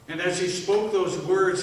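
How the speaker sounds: usual speaking voice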